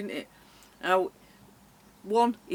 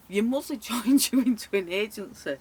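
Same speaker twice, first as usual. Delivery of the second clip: louder, laughing through the words; amused disbelief; 3 semitones higher, 7 dB louder than usual. Real, not acted. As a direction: laugh as you spell out the absurdity. Laughs at the absurd arrangement.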